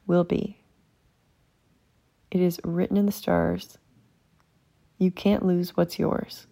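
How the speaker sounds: ordinary delivery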